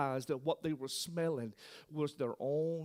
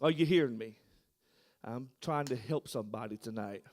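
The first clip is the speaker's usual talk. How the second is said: ordinary delivery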